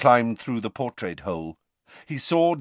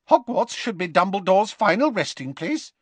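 Flitwick; 11 semitones higher, 3 dB louder than usual. A narrator's reading. Flitwick speaks.

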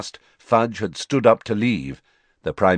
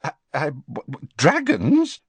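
spluttering; 6 semitones higher than usual. Acted. The Prime Minister splutters at Fudge's news of dragons, stammering. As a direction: trip over the words in shock. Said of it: "spluttered the Prime Minister".

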